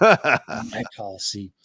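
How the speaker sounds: low, slow tough-cop impression; amateur movie-cop voice; Dirty Harry. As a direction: draw it out low like a deadpan movie detective. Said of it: "Dirty Harry accent".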